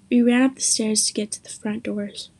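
usual speaking voice

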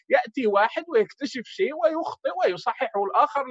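normal talking voice

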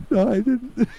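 high whiny denial; pleading. Retold or imitated. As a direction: plead innocence like a scared kid. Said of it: "I was like".

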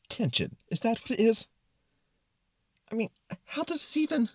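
high-pitched, exasperated disbelief; concerned Brain. Acted. Brain questioning the tension, high and concerned. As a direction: fret high, giving up mid-question. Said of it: "in a very concerned tone".

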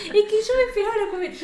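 usual speaking voice

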